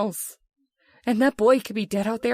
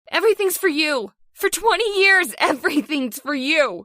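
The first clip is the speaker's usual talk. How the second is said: higher, louder bitter accusation; bitter outburst; 4 semitones higher, 3 dB louder than usual. Acted. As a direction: hurl twenty years of resentment at him. Wife finally confronts selfish husband.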